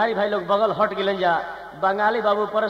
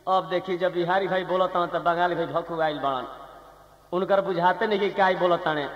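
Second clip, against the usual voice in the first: ordinary delivery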